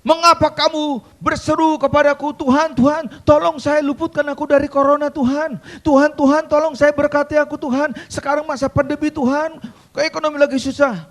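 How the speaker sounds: loud, high rebuke of pleading voices; exasperated divine scolding, mocking the cries; angry God. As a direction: thunder it back at them like an annoyed father. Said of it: "Kesel dia, marah dia".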